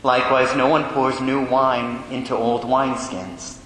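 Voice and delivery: formal Gospel proclamation; cathedral echo. Acted. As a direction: reverent liturgical reading. Priest proclaims the Gospel at Mass.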